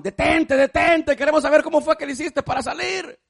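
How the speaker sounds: high, loud call after the fleeing frog. Retold or imitated. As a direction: shout after her to stop. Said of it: "Y aquellos le gritaban".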